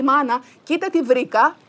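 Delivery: high, loud eager call; excited boy. Acted. Son running to mother with his find, loud and eager. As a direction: burst in showing a treasure.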